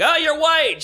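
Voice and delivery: high mock surprise. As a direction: act startled at the obvious. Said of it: "we're like".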